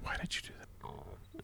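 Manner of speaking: whispering. Real, not acted. Co-host reacts to a mishap.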